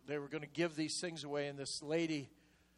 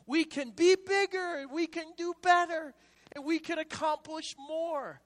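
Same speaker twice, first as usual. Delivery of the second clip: much higher, louder preaching climax; preaching at full force; 12 semitones higher, 8 dB louder than usual. Acted. Preacher rousing the church, full-force preaching. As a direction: lift the congregation up with it.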